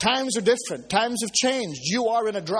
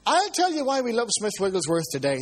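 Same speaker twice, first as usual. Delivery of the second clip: ordinary talk, nothing marked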